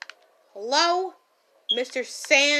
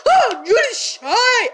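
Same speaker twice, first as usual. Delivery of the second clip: high, loud, slow astonished cry; surprised gasp; 10 semitones higher, 12 dB louder, much slower than usual. Real, not acted. Teasing a zombie with mock surprise.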